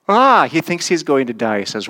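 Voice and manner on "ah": sharp, high, loud yelp of panic; a sudden burst of alarm; frightened cry. Retold or imitated. Isaiah sees God's glory and cries out, high and loud. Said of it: "And he's like".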